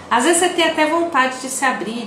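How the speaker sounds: ordinary talk, nothing marked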